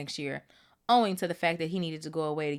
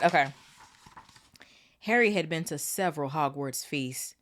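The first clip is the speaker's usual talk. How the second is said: ordinary delivery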